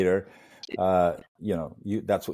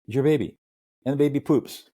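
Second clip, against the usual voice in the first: ordinary talk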